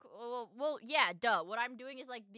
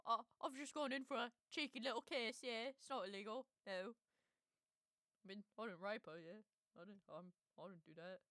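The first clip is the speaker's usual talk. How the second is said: put-on British accent. Streamer mocking the photographer's defence in a British accent. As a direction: imitate the man's defence in a British accent, trailing away. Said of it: "I do a good British accent".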